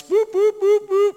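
high, loud repeated boops; imitated warning signal. Preacher mimicking a warning light going off, high loud beeping. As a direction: sound four evenly spaced, high-pitched alarm boops. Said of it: "that's like headlights".

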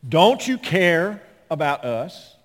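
loud, high, drawn-out complaint; hammed-up aggrieved wail; mock whining. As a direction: whine it out slowly at full volume. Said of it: "So here come the whiners".

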